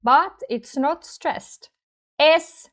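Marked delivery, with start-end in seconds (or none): 2.2-2.7 "S" said as a punched "es"; emphatic, isolated "es"; strongest syllable. Shown as a demonstration. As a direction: hit "S" high and loud. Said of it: "is the strongest part".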